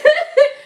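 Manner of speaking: short high giggle; gleeful giggle; excited laugh. Real, not acted. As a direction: laugh with real glee. Excited about Santa.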